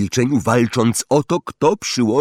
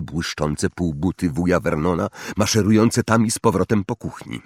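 ordinary delivery, nothing marked

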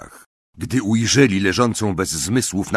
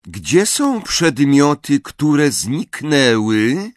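high, slow singsong riddle voice; melodious door knocker; 6 semitones higher, 4 dB louder, much slower than usual. A narrator's reading. Eagle knocker puts a riddle at the door, melodious. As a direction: pose the riddle melodiously, stretched out. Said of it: "melodyjny głos wydobywający się z kołatki".

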